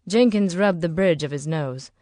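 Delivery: plain reading voice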